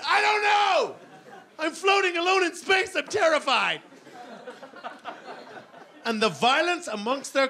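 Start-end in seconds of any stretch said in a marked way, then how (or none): 0.1-3.8 put-on high, shouting little kid; a toddler lost and scared, yelling; panicky toddler. Acted toddler answering, lost in time.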